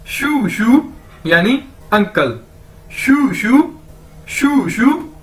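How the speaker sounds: slow, drawn-out शू शू for uncle; pronunciation demo. Shown as a demonstration. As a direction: Chinese teacher modelling शू शू. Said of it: "इसको pronounce कैसे करना है?".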